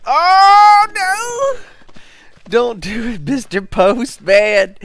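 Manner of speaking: panicked high screaming; being chased. Real, not acted. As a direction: shriek in terror at the attacker. Bear attacks during chase.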